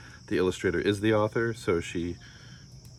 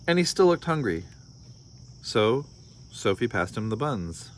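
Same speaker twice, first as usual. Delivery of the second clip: storybook read-aloud with voiced characters; story narration; 4 semitones higher than usual. A narrator's reading. Parent reading a picture book to listeners, lively storytelling. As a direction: read it like bedtime story time.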